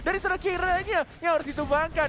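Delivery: loud high hype call; esports caster. Real, not acted. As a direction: yell out the big combo. Caster reacts to big combo.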